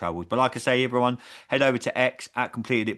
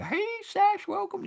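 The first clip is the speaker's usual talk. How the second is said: very high hammed-up Hillbilly accent greeting; slower than usual. Host greeting co-host in a Hillbilly accent. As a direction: squeal a Hillbilly hello. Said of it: "I done a Hillbilly accent".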